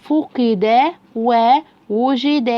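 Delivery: very slow, every syllable held; slow sounding-out. Shown as a demonstration. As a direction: read it piece by piece like a beginner. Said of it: "أسرع يا بطل".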